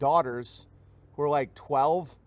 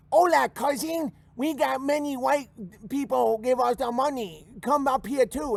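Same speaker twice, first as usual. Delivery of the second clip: much higher mock Mexican accent, beckoning; Mexican cousin calling; 8 semitones higher than usual. Migrant calling his cousin to come, high mock Mexican accent. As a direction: call your cousin up north. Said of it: "he's gonna be like".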